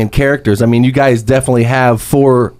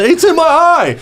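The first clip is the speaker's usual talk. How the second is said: high, slow hammed-up cry of pain; mock pained yelp; slower than usual. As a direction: yelp it like it stings. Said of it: "Dying laughing".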